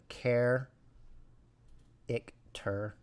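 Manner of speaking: 'care, ik, ter' in three separate English pieces; English 'care' punched, then 'ik', 'ter'; English syllable breakdown. Shown as a demonstration. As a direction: split 'character' as 'care, ik, ter'. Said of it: "the three syllable".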